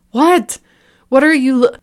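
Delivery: high, loud startled cry cut short; frightened shriek. A narrator's reading. Liam shrieking at something behind him, high and loud. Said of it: "Liam shrieked and spun around".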